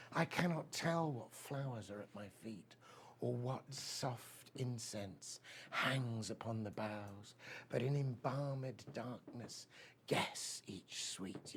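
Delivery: soft, slow, hushed poem reading; hushed recital. Acted. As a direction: let it fade to a near whisper. Recites Keats ode.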